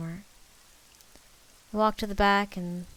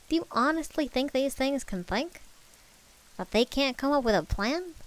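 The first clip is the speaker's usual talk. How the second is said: higher, scornful Southern and New York blend; Southern-New York mix; 6 semitones higher than usual. A narrator's reading. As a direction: argue in a muddled Southern-New York accent. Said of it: "weird mix of Southern and New York".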